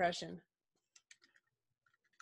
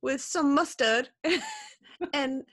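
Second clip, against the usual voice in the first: David Beckham impression; 4 semitones higher than usual. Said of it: "order a falafel as David Beckham".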